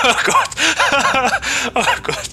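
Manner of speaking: high laughing shriek; embarrassed laughing. Real, not acted. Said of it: "I can't do this".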